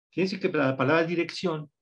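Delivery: normal talking voice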